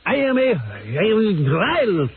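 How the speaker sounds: put-on high, slow boastful Israeli accent; imitating an Israeli boss. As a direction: brag like the arrogant Israeli boss.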